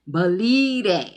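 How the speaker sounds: loud, drawn-out catchphrase voice; Martin. Retold or imitated. Quoting a catchphrase to viewers, loud slow comic impression. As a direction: belt it out slowly, stretching the middle. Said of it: "in my Martin voice".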